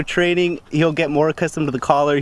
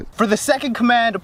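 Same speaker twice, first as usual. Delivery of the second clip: ordinary talk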